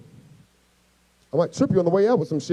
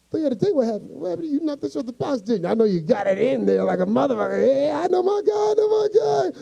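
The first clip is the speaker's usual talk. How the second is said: high-pitched eager pestering, climbing higher; excited friend; 8 semitones higher than usual. Retold or imitated. Best friend prying about the date, high excited squeal. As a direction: egg him on, rising to a thin squeal.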